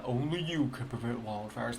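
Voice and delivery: low-pitched imitation of a commercial bear; lightly voiced deep bear. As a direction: put on a deep mascot voice. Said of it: "with that deep bear voice".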